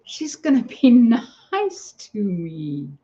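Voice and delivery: slow, high mock-hopeful musing; a hint of bitter sarcasm, slowed down; sarcastic thought. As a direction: sneer at the hope she'd be nice. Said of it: "and I thought".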